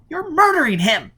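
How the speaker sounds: high, loud panicked cry; frantic scream. Acted. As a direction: scream to stop him. Robin pulling at Foyle.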